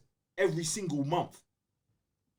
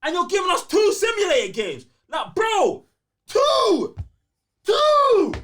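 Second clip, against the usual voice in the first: incredulous yell; 9 semitones higher, 7 dB louder than usual. Real, not acted. Yelling about two simulator games.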